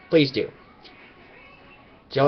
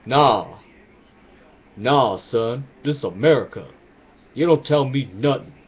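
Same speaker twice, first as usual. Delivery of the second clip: lightly voiced street-talk Obama, lower; a hint of a swaggering president brushing them off; tough-guy Obama; 3 semitones lower than usual. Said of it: "President Obama should have came up there and said".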